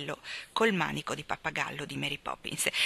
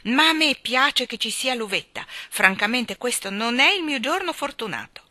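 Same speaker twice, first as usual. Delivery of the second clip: loud, higher-pitched sour complaint; bitter grumble; 5 semitones higher, 5 dB louder than usual. A narrator's reading. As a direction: complain aloud about your bad luck. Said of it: "brontolò con amarezza". (lamentela amara, a voce alta)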